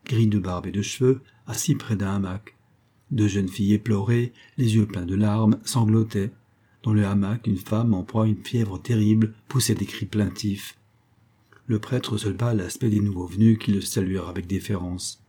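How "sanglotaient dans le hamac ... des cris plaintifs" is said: ordinary delivery, nothing marked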